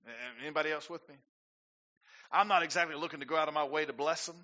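ordinary talk

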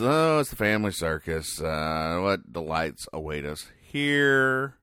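normal talking voice

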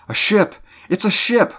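high, loud shout of discovery; excited cry. A narrator's reading. Crew sighting a vessel in the fog, crying out excitedly. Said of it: "we cried excitingly".

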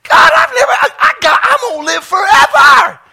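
very high, loud, overwhelmed shout; ecstatic shouting. Acted. Believer realizing the promise of everlasting life, shouting ecstatically. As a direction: burst out half crying, half laughing with joy. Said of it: "you're shouting".

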